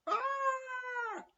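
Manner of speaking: very high shriek of fright; Matron's scream. Acted. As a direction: shriek like a matron who saw a pigeon. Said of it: "screamed Matron".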